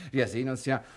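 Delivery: ordinary delivery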